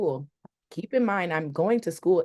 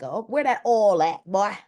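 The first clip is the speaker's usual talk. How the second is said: slightly raised, lightly drawled Southern voice; hammed-up country accent; 4 semitones higher, 3 dB louder than usual. Shown as a demonstration. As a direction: play a down-home country man. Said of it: "not just like".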